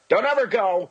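high, sudden mock-alarmed cry; hammed-up urgent plea; joking warning. Real, not acted. Joking warning about the town.

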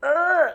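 loud, high startled bleat; short imitated sheep-like grunt; weird sheep noise. Shown as a demonstration. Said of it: "that weird sheep noise".

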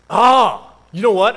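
loud, high pounce on the topic; hammed-up excited exclamation; eager outburst. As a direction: burst in like you've found an ally. Acted delivery guy seizing on pastor.

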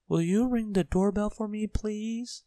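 high small voice asking; tiny Mister Small. A narrator's reading. Small man asking the postman for help, high voice.

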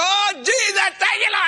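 very high, ecstatic prayer cry; hammed-up church holler. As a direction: wail out thanks to heaven. Acting his loud morning praise.

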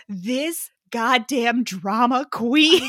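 loud, very slow drawn-out complaint; exasperated. Real, not acted. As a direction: stretch each word in amused exasperation. Exasperated reaction to his speech.

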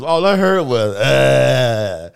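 high, loud hammed-up witness; mock witness voice. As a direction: overplay a frantic witness. Mock witness telling detectives.

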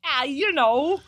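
high, loud exaggerated mimicry of a friend; mocking co-host impression. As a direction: tease a friend by hamming up their verbal tic. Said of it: "Is that what I sound like?".

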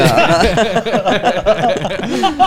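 long, loud high laugh; uproarious fit of laughter. Real, not acted. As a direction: crack up loudly. Laughing at late-night nonsense.

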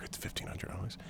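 hushed unvoiced whisper, guilty secret; whispered admission of a pricey autograph. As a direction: breathe it like a confession. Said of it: "confessing the price, hushed".

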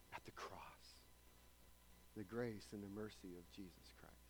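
hushed whisper of grace; tender whispered benediction; whispered blessing. Acted. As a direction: breathe the words softly to the congregation.

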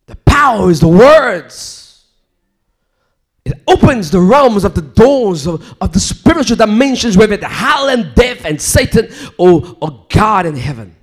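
loud, rising sermon cry; fiery preaching. Acted. Preacher crying out to the congregation, fiery. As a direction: preach it out with full force.

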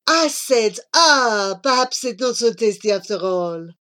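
French suitor, let down; Jean-Claude. Acted. Jean-Claude having dislodged the gum, ruefully explaining. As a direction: recover with a French-accented, rueful 'ah'.

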